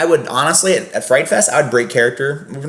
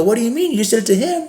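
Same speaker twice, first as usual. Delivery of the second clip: higher, incredulous comeback; a sarcastic raised-pitch argument; mock indignant retort; 10 semitones higher than usual. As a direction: fire it back, pitched up in disbelief. Arguing back at the guests.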